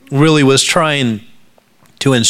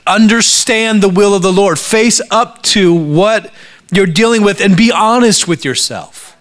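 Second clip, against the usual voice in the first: high, raised preaching voice; forceful exhortation; 8 semitones higher, 3 dB louder than usual. Acted. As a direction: drive the command home to the congregation. Preacher proclaims Paul's command at force.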